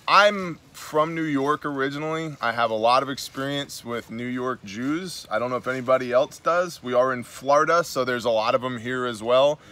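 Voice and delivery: ordinary delivery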